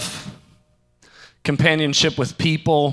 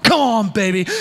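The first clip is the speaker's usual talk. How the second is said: high, louder hyped-up whoop; excited cheer; 8 semitones higher, 4 dB louder than usual. Retold or imitated. Celebrating the deal with Matt, high excited cheer. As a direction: cheer a buddy on, higher. Celebrating after getting tickets.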